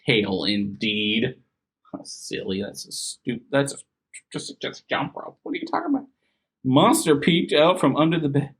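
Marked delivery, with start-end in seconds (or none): 1.9-5.4 whispering. Acted. Unvoiced aside mommy voice. 5.4-6.1 silly mom voice. Acted. Mocking high mom voice.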